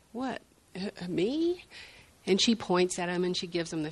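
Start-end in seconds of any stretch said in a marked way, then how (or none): 0.1-1.6 higher, startled little "me?"; surprised stranger. Retold or imitated. Shopper at the aisle end, surprised, pointing at himself. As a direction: sound caught off guard. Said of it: "they're like".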